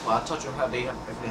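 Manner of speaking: normal talking voice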